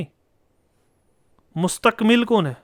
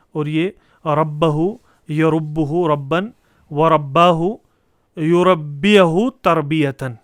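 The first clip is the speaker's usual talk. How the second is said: slow recital; 4 dB louder, much slower than usual. Shown as a demonstration. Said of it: "reciting verb forms".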